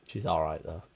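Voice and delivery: normal talking voice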